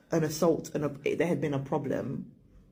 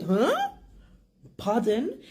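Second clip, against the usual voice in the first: incredulous; 7 semitones higher, slower than usual. Retold or imitated. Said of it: "Like, I said".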